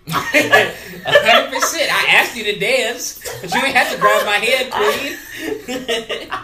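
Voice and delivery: loud, high, laughing through words. Real, not acted. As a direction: crack up while boasting. Laughing boast.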